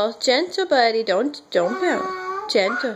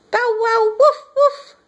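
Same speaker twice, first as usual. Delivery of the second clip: higher, louder bow wow woof woof; puppy bark imitation; 8 semitones higher, 4 dB louder than usual. Shown as a demonstration. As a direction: yap like an excited little puppy. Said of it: "What's the dog say?".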